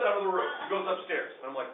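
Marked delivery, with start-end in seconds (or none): none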